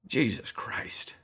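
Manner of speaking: breathy, half-whispered exasperation; sighing in dismay; weary sigh. Acted. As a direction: let it out on a tired sigh. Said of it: "Twee Bruce sighed".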